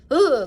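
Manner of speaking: loud shuddering ugh; disgusted ugh. Retold or imitated. Old man wiping the paw off his hand, loud shudder. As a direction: shudder off the touch. Shivering after touching the paw.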